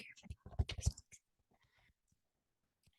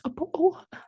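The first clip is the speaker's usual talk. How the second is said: mock British accent, pitched up; bad British accent; 7 semitones higher, 4 dB louder than usual. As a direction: put on a hammed-up British accent. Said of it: "That's the worst accent ever".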